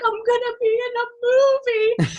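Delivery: high, wailed mock sobbing; hysterical crying. Actress telling her mom the news, hysterically crying. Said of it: "I'm hysterically crying".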